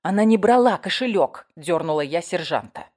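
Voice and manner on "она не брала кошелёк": loud, high defence of the accused; raised, emphatic denial; insistent protest. Acted. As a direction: object urgently to the sergeant. Narrator protests to the sergeant.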